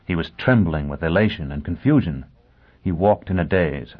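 plain reading voice

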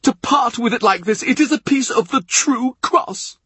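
high-pitched, scandalised protest; aghast, voice held high throughout; upset, astonished. A narrator's reading. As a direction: protest in disbelief, pitched right up. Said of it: "George is upset, astonished".

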